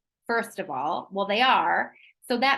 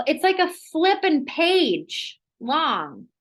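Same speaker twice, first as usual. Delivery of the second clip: 1 exasperated; 6 semitones higher, 5 dB louder, slower than usual. Real, not acted. Exasperated at the ingredient list.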